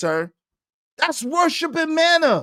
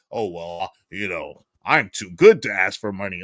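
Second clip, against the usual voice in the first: mock humble voice. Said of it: "act like".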